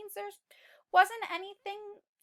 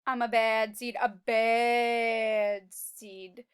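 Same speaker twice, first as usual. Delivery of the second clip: bad seed voice; 4 semitones lower, 5 dB louder, much slower than usual. Teacher reading the bad seed's boast to kids, slow and drawn out. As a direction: stretch out the last bad seed slowly.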